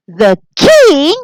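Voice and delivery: high, loud panicked screech. A narrator's reading. Charlie hears the king is coming, high loud screech. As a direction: screech it out in shock. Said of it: "screeched Charlie McBean".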